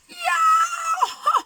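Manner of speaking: a long, very high, loud slow wail; pained howl. A narrator's reading. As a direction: howl as the jaws hit the sore tooth. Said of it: "howled Tiny".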